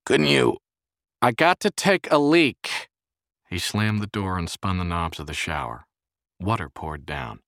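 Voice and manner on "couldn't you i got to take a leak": loud, high, impatient shout; raised voice, urgent and fed up; exasperated yell. A narrator's reading. Pained man snapping at scared friend.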